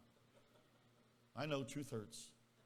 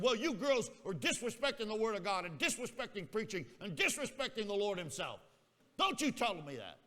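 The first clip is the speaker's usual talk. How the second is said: raised, loud acted rebuke; righteous indignation; 5 semitones higher, 5 dB louder than usual. Indignant student accuses girls of disrespecting preaching, righteous rebuke. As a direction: throw it back at them, full of righteous outrage. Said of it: "filled with righteous in-, indignation".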